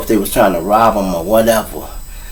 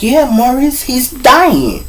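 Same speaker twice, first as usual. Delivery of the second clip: high-pitched imitation of an upset mother; retold distressed mother; 12 semitones higher, slower than usual. Mother telling her son's friend he is dying, high and distressed.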